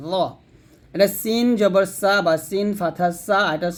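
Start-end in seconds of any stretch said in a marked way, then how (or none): 0.1-0.1 র pronounced thick, with a rounded vowel; full-mouthed emphatic za on র; heavy za. Shown as a demonstration. Teacher repeating the model letter, র said heavy. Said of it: "মোটা করতে হবে".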